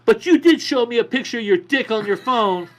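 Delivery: high, loud retold accusation; mock accusing yell.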